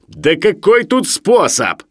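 high, loud, drawn-out tipsy dismissal; chatty after vodka. A narrator's reading. Sharikov explains his method after vodka, loosened and chatty. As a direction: wave the question away loudly. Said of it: "становясь словоохотливым после водки".

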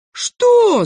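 very high, loud shriek of disbelief; outraged shout. A narrator's reading. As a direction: cry out in shock. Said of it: "закричали тут все". (возмущённый вопль)

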